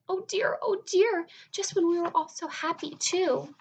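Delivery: high, loud fretful lament; crying Ladybug. Acted. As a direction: wail like a tearful little beetle. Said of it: "cried Ladybug".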